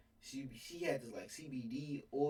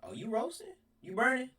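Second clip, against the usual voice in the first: mock taunting; 11 semitones higher, 5 dB louder than usual. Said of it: "I throw a little warning shot at you".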